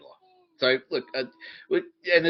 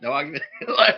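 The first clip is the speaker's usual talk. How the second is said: normal talking voice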